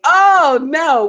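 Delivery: loud, high "oh no" of amused shock; high exclamation, falling off; shocked outcry. Real, not acted. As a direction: cry out in scandalized disbelief. Exclaims at the comment's punchline.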